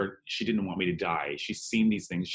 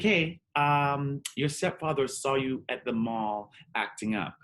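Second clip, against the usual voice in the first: imitating mom; 5 semitones higher than usual. Son retells mom confronting him about the mall, imitating mom. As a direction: lightly play the mom passing on gossip. Mom voiced in coming-out story.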